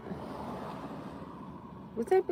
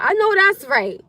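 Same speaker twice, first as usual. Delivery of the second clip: high, loud "I know that's right"; excited affirmation; 9 semitones higher, 7 dB louder, slower than usual. Real, not acted. Streamer cheering a verse, excited. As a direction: burst out in delighted agreement.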